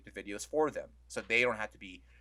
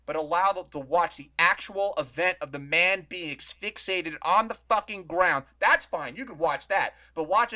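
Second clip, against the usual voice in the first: indignant; 5 semitones higher, 8 dB louder than usual. Real, not acted. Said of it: "indignant rant".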